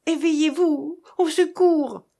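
high, loud rallying cry; acted cry of alarm; calling for help. A narrator's reading. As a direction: cry out to rouse everyone.

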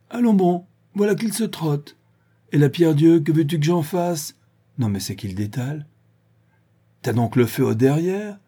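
high, loud, mocking taunts; jeering old druid. A narrator's reading. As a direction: shout jeers after a fleeing man. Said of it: "les quolibets du vieux druide". (quolibets moqueurs du vieillard)

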